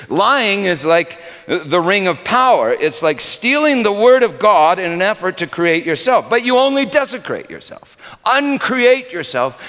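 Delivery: loud, high impassioned sermon; rising preacher's fervor. Acted. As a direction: hammer each phrase from the pulpit. Preaching on lying, ring of power.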